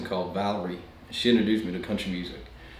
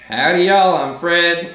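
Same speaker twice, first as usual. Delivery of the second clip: loud, higher hammed-up cowboy howdy; cowboy greeting; 8 semitones higher, 9 dB louder, slower than usual. Eighteen-year-old Fred introduces himself at the talent show, hammed-up cowboy howdy. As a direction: belt out a big country hello. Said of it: "from north of Sweden".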